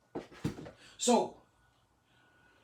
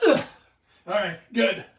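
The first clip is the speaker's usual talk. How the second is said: breathless after push-ups; panting, catching breath; 8 dB louder, faster than usual. Real, not acted. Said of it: "I gotta catch my breath".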